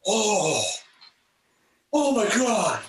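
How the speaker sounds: high awestruck gasp of a fan; mock fan, breathless and high; starstruck fan. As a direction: do the overwhelmed fan. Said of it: "I think I can still do the impression".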